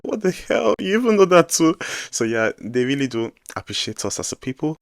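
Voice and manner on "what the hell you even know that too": high, loud retold astonishment; amazed disbelief. Nigerian hears a Korean girl speak pidgin, retold high-pitched amazement. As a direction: blurt it out high, reenacting your own shock. Retelling own amazed reaction to her.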